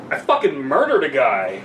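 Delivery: ordinary talk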